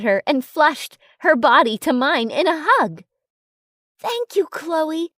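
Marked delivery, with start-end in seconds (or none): 4.0-5.1 slow, sincere thanks, rising higher; mean every word, slowly; truly grateful. A narrator's reading. Thanking best friend for dressing her, slow and sincere. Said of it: "I told her truly".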